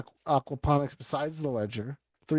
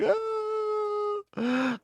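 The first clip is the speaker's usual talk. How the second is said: high crying wail. Acted. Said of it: "He's like".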